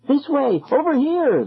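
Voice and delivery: high, urgent call to a friend; breathless girl calling. A narrator's reading. Girl calling her friend through the fog, breathless. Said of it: "the girl repeated breathlessly to her friend".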